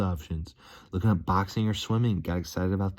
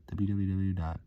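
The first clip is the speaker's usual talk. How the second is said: normal talking voice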